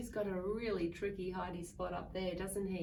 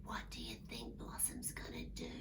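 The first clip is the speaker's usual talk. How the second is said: much lower, fast whisper; hushed question; 10 dB quieter, faster than usual. A narrator's reading. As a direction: whisper to the children. Asks children in suspense.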